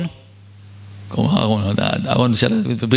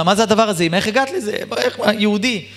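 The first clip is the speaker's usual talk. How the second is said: shocked disbelief; 7 semitones higher than usual. Acted. Son shocked at mother's revelation.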